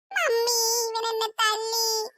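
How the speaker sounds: slow, drawn-out put-on sob to mummy; fake crying. Girl fake-sobs to mummy that her brother hit her. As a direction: whimper it out slowly to win mummy's side. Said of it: "നിർത്തടി നിന്റെ കളക്കരച്ചില്".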